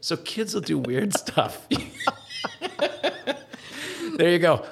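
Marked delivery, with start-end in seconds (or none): 1.7-4.1 laughing. Real, not acted. Laughing at own story.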